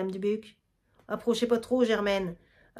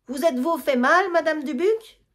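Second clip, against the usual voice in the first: worried calling; 6 semitones higher, 4 dB louder than usual. Acted. Calling to fallen mother-in-law.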